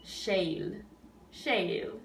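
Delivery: "Shale" one joined syllable, sce and il merged; elided sound. Shown as a demonstration. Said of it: "combine them into one sound".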